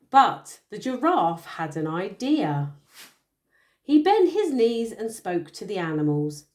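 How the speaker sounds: bedtime storytelling voice; story narration. A narrator's reading. Parent reading a picture book to a child, storytelling. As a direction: read the tale aloud to a little one.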